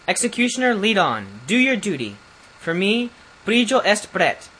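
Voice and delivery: resolute prince. A narrator's reading. Condemned prince orders the executioner to lead on, resolute. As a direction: bravely order your own execution.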